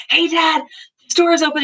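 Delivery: hint of an excited daughter; eager little girl. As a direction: sing it out like a proud child. Daughter calls to her dad.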